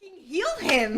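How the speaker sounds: laughing mock plea to heal him; giggling mock begging. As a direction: plead through laughter, half joking.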